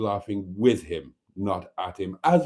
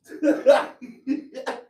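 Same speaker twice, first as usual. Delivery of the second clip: high laugh. Real, not acted. Host laughing at voice note.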